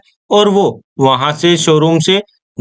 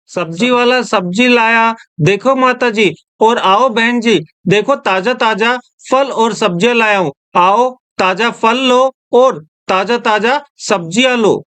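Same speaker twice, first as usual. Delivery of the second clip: sing-song street hawker call, pitched up; melodic vegetable vendor call; 4 semitones higher than usual. Acted. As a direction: call out fresh fruit and vegetables. Vendor calling out wares.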